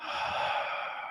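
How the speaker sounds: long, soft breathy exhale; long sigh. Real, not acted. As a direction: let out a slow, quiet breath. Breathes out while teaching.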